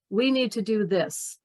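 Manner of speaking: firm statement dropping on "this"; falling, confident ending. Shown as a demonstration. Coach models a confident statement, pitch going down on "this". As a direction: bring "this" down to close the sentence. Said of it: "force yourself to go down".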